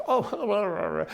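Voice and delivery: hammed-up drunk mumbling; mock drunk. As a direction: imitate a slurring drunk's wordless reply. Imitating a drunk woman's slurred reply.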